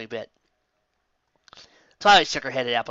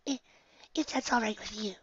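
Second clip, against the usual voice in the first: much higher, soft, timid pony voice; timid Fluttershy voice; 9 semitones higher, 9 dB quieter than usual. A narrator's reading. Fluttershy to her friends, timidly high and soft. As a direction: shrink back, voice small and high.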